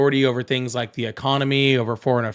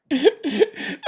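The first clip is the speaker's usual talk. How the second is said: short high-pitched guilty laugh; can't help a high giggle; 10 semitones higher than usual. Real, not acted. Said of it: "I should not be laughing at it".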